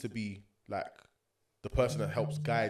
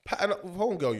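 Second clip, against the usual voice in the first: voicing his sister; 8 semitones higher, 4 dB louder than usual. Sister voiced telling brother off.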